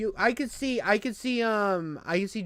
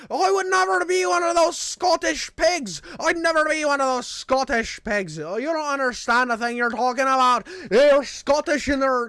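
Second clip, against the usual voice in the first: loud, high mock Irish rant; hammed-up outraged Irishman; going off; 6 semitones higher, 7 dB louder than usual. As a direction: imitate an Irishman blowing up at an insult. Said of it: "dude goes off on me".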